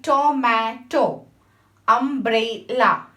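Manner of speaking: "tomato" and "umbrella" said slowly, each in three separate beats; syllable by syllable. Shown as a demonstration. Teacher dividing words for the class, slow and syllabic. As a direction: stretch "tomato" into to-ma-to, slow and even. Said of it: "thrice divided the word tomato".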